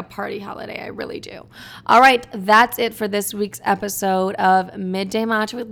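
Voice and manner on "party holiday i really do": soft, breathy confiding whisper. Real, not acted. Host wishing Thanksgiving were a party, whispered. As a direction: let it fade into a secret. Drops to whisper mid-sentence.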